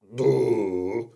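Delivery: drawn-out humming bear grumble; bear rumble. Acted. Bear just kissed on the nose, long rumble. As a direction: rumble like a bear taken aback. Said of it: "brummte da der Braunbär".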